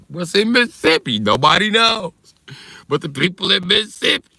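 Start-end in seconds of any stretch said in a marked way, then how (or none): 0.1-2.1 baffled driver. Retold or imitated. Driver baffled on empty freeway.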